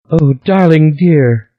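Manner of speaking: high, tearful cooing; high tearful coo of a giant girl; crying voice. A narrator's reading. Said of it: "said the crying voice".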